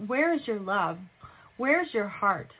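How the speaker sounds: ordinary delivery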